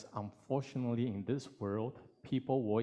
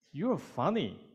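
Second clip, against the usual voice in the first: bright compliment; 7 semitones higher, 5 dB louder, slower than usual. Acted. Voicing someone's compliment.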